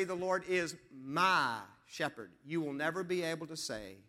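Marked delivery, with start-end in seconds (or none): 0.0-2.3 slow verse with "my" held long; deliberate, drawn-out emphasis on "my"; stress on "my". Shown as a demonstration. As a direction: stretch "my" before a softer "shepherd". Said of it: "Until you can say".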